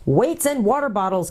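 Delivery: high-pitched exasperated oath; angry. A narrator's reading. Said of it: "came an angry voice".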